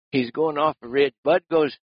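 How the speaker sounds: ordinary delivery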